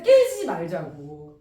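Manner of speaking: 깨지지 말자고 said slowly, each word weighted; slow emphasis. Shown as a demonstration. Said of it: "answer slowly emphasized".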